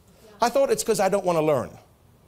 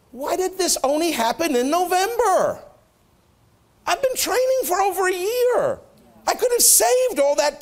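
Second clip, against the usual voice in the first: exasperated complaint; 10 semitones higher than usual. Retold or imitated. Complaining to the Lord, retold.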